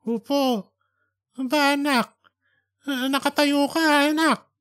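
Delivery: high, slowed, disbelieving call to his son; an old man who can't believe his son is standing; startled old father. Acted. Father surprised to see son.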